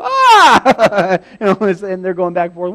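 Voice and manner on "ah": loud, high scream; hammed-up terrified yell; frightened scream. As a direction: longer shriek of fright. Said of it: "Mark go".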